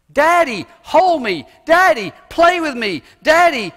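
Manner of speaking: put-on high, loud childlike pleading; little child calling daddy. Little kid calling out to his daddy, high and eager. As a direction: play a small child calling his dad. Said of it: "little child's word of the most intimate endearment".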